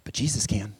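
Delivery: breathy whisper of hope; hushed, intimate whisper. Real, not acted. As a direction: drop to a whisper on the name. Quiet turn after a soft build.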